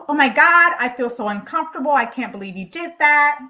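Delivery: hammed-up shock and discomfort; flustered outburst.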